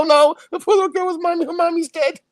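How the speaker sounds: high hammed-up weepy voice; milk the sadness; mock tearful. Mocking the movie's sad line, high and weepy. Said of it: "They go".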